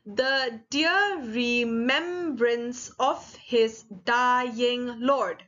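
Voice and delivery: very slow, each syllable set apart; counting out syllables. Shown as a demonstration. Teacher counts a poem line with students, syllable by syllable. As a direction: read it beat by beat for counting. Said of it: "there are ten syllables".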